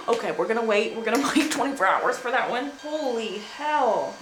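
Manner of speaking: usual speaking voice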